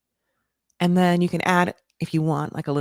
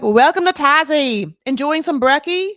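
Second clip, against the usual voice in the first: put-on a shaky, overdone Australian accent, higher and louder; bad Australian accent; 8 semitones higher, 6 dB louder than usual. The mother greeting her son's date, in a hammed-up Australian accent. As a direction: play a cheery Aussie mum badly in an Australian accent, slowed. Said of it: "I cannot do that accent to save my life".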